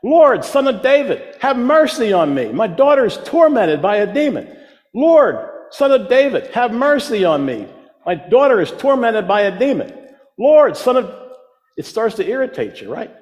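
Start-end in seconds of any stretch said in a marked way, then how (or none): 0.0-11.1 loud, high desperate calling out; pleading shout. Retold or imitated. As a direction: cry out after him, begging. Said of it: "she kept shouting after him".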